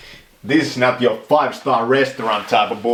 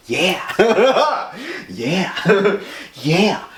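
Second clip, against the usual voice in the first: giggling through each yeah; laughing yeahs; 7 semitones higher, much slower than usual. Real, not acted. Vlogger laughing at his own joke, giggly yeahs.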